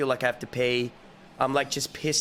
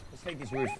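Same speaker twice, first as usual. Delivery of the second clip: ordinary talk, nothing marked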